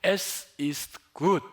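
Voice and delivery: "Es ist gut" very slow with gut rising; slow German demo. Shown as a demonstration. Pastor teaching the German phrase again, slow. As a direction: lay out "Es ist gut" word by word. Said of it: "It is good".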